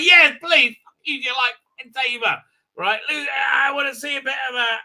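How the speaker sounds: high, loud excited begging; hammed-up enthusiastic demand; eager pleading. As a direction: plead like an over-excited fan. Narrator eagerly answers character.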